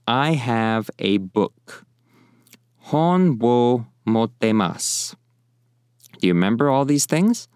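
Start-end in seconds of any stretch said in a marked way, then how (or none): 0.1-1.8 spoken slowly, word by word; slow careful model sentence. Shown as a demonstration. Teacher to students, slow model sentence. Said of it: "To say". 2.8-5.1 "Hon wo mottemasu" said slowly with clear Japanese vowels; Japanese model phrase. Shown as a demonstration. Teacher to learners, slow Japanese demo. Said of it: "To say".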